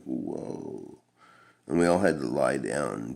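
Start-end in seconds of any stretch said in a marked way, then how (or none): 0.0-1.0 breathy, hushed awe; stoned teen feeling the hash kick in; dreamy whoa. Retold or imitated. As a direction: drift off in a daze. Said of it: "It was like".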